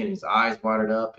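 ordinary talk, nothing marked

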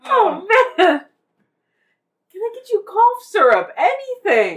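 high, laughing concern. Real, not acted. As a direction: talk through laughter. Laughing concern over coughing.

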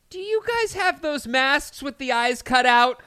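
high goofy clueless guy; dumb guy voice. Retold or imitated. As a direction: ask it like a clueless doofus. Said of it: "the guy walks up and goes".

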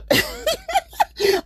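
helpless high laugh at an absurd memory; amused cackle; high-pitched laugh. Real, not acted. Laughs at neighbor's barbecue mishap.

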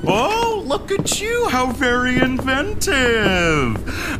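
hammy fortune teller flattery; psychic performer voice. Acted. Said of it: "The Riley Shadow Moon performance".

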